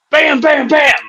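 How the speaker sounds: high, punchy bangs; imitated gunshots. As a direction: fire off mock gunshots by mouth.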